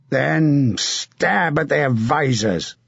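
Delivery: a battle-hardened knight urging a comrade on; hard, rough commander's voice; grim veteran knight giving orders; gruff knight. Acted. Sir John advises William.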